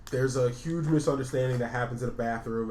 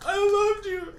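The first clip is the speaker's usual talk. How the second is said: high, louder hammed-up sob; mock crying; 6 dB louder than usual. The rejected date crying to the girl, hammed-up sobbing. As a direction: wail it like a heartbroken date. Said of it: "he's sitting there crying".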